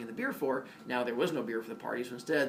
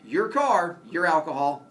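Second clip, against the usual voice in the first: stern cop; 4 semitones higher, 7 dB louder, slower than usual. Retold or imitated. Cop announcing the arrest.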